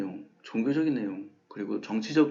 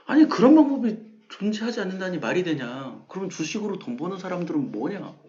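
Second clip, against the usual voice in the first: higher and louder, mock outrage; indignant retort; 6 semitones higher, 4 dB louder than usual. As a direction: play the offended investor, raising pitch. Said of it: "저에게 화를 내면서 얘기해요".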